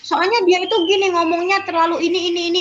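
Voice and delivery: put-on child explaining to an adult why they got upset; complaining child. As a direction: voice a child telling what bothered them. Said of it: "child explaining complaint".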